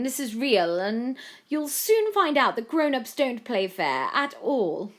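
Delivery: high, loud, scornful sarcasm; mock-apologetic. A narrator's reading. Violetta warns Joe grown-ups don't play fair, scornful. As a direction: feign sympathy, then lecture harshly. Rival mocks the boy.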